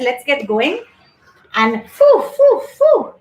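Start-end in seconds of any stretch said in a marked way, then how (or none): 1.5-3.1 sharp, loud, high puffs of breath, blowing thoughts away; phew, phew, phew, pushed out hard. Shown as a demonstration. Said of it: "go away all these silly thoughts".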